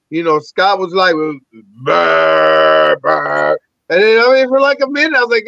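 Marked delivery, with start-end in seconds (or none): 1.8-3.6 long, low mock burp; imitated burp. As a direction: belch it out low and drawn-out, mimicking him. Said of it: "he had a burp".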